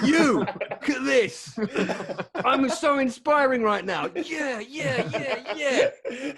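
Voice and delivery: high, loud, slow hype voice; mock pumped-up jogger. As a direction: pump yourself up mid-jog. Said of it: "You imagine him out on a jog".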